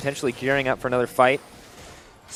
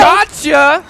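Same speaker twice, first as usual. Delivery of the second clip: sudden loud yelp, much higher; excited shout; 13 dB louder than usual. Real, not acted. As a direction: blurt it out as the catch lands. Play-by-play sudden catch.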